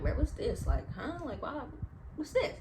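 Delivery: retold puzzlement, rising into questions; puzzled discovery. Finding a bump on her ear, puzzled.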